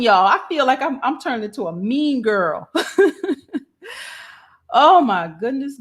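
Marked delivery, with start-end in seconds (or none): 2.7-3.6 a high burst of laughter at herself. Real, not acted. Host laughs at herself for getting mean, high laugh.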